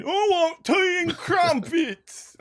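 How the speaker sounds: very high, drawn-out British caricature; mock British accent. Host mocks all forty accents, in a hammed-up British accent. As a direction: wail it slowly in silly British. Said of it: "There's forty accents, and they're all just saying".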